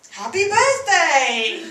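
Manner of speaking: loud, drawn-out birthday cheer; celebratory cheer. Acted. Friend greeting his mugger as a birthday guest, celebratory. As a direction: call it out slowly like a party surprise.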